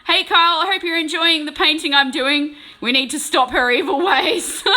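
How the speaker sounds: loud cheery shout-out; loud call-out. Real, not acted. Greeting Kyle in the chat, loud and cheery. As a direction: call out to Kyle loudly and brightly.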